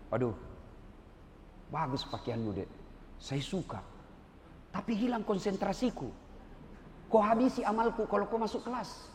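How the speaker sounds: soft, low and kind to a badly dressed student; gentle teacher voice. Ustadz kindly sends student out, soft and low. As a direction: ease her out of class without scolding.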